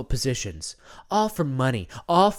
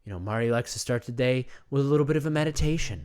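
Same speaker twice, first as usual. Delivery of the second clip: soothing self-care narration; 4 semitones lower, 5 dB quieter than usual. Acted. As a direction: float each word like a spa ad. Meditation-style self-care narration.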